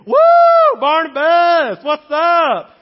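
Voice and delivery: high, very loud whooping holler; hammed-up shouted cheer; mock cheering crowd. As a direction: whoop it like a rowdy fan, stretched out. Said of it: "they didn't think".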